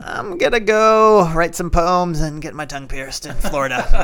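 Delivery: high, laughing mock-teen drawl; rebellious teen voice. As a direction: play the carefree rebellious teenager. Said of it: "I was like".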